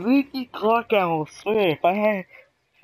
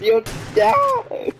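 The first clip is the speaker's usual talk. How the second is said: gleeful taunt; 4 dB louder than usual. Real, not acted. Gamer taunting downed player.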